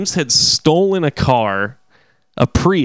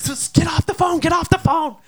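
high urgent mock yell; mock shout. Yelling at the girl on the insurance call, high mock yell. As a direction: yell at her to hang up, half laughing. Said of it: "I was like".

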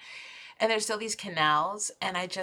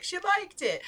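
high-pitched voice for a crow; crow talking; 11 semitones higher than usual. Retold or imitated. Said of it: "they'll be like".

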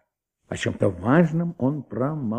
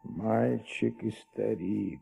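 slow brooding; slower than usual. Acted. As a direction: turn the riddle over slowly. Maigret musing alone.

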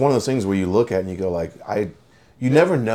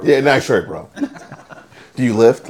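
lightly played gym bro, louder and higher; a hint of a loud bro greeting; mock bro talk; 5 semitones higher, 8 dB louder than usual. Guys awkwardly complimenting each other, mock bro talk. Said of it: "Us guys, we're like".

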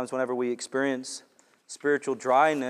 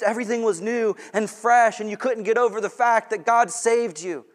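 loud, high, exultant preaching; full-force preaching; 6 semitones higher, 7 dB louder than usual. Acted. Preacher to congregation about first zeal: loud preaching. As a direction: preach the joy of being saved.

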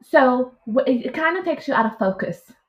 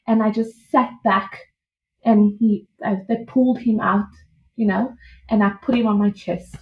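ordinary talk, nothing marked

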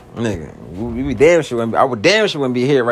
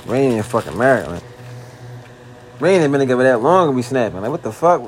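ordinary talk, nothing marked

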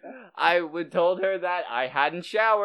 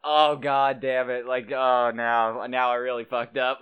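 mortified; 6 semitones lower than usual. Retold or imitated. Retelling his thoughts after turning her down, mortified. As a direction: sink low into retold embarrassment. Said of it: "I was mortified".

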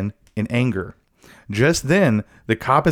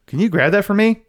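higher, playful request; high joking ask; 8 semitones higher than usual. Retold or imitated. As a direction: ask it lightly, pitched up.

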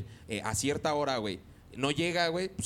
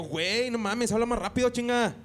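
northern tone; 7 semitones higher, 4 dB louder than usual. Retold or imitated. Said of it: "tenía el pinche tonito".

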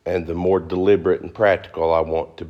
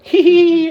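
mock giggle; 8 dB louder than usual. Mocking giggle.

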